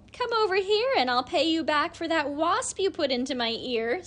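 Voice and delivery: high, loud angry cow; snapped. A narrator's reading. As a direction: snap the threat out. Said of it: "snapped Lily".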